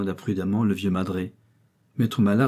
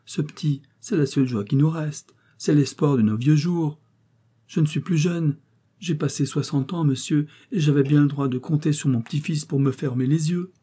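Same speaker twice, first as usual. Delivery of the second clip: higher-pitched, put-on tearful plea; 6 semitones higher than usual. A narrator's reading. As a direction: lament like a pitiful old grandfather. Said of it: "d'une voix pleine de larmes". (voix plaintive, pleine de larmes)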